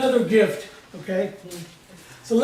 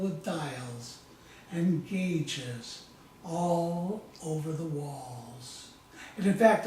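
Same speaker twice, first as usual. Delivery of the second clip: calm, measured hypnotic storytelling voice; softer and lower than lecture talk, unhurried; guided hypnosis; 4 semitones lower, 5 dB quieter than usual. Acted. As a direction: lead them gently through a visualization, pausing often. Leads a guided visualization.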